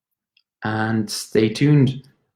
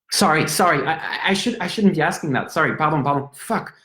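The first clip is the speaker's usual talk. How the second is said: flustered apology; 8 semitones higher than usual. Acted. Flustered apology after a rude question.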